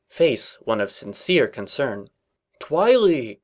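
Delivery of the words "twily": gasp. A narrator's reading. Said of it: "he gasped".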